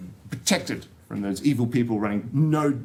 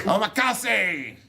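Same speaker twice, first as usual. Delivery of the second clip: "Omakase!" drawn out slowly and higher, oh-ma-ka-SEE in a hammed-up Japanese accent; terrible Japanese accent; 8 semitones higher, much slower than usual. Speaker answers his own pronunciation question in a bad Japanese accent. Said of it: "that was a terrible Australian interpretation of Japanese accent".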